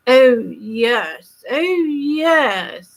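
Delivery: mock the posh English aristocracy with a drawn-out plummy accent; exaggerated upper-class English accent, sneering at the aristocratic set. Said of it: "which would make you nauseous to hear it".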